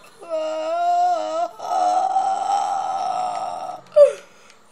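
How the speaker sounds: fake crying wail. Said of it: "I'm crying right now".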